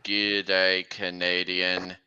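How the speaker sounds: deep, slow creature catchphrase; low and drawn out, like a monster; killer freight car. Acted. Freight car's catchphrase to a victim, deep slow voice.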